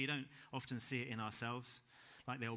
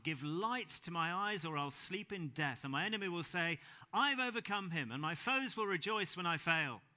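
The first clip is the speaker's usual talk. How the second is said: impassioned reading; 4 semitones higher, 6 dB louder than usual. Acted. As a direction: cry the plea out to God. Psalm reading building intensely.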